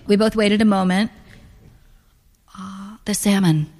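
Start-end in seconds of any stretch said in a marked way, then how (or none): 2.6-3.7 drawn-out "uh", then a tentative choice; hesitant order. A narrator's reading. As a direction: give the order after a moment's hesitation. Hesitantly giving her dinner order.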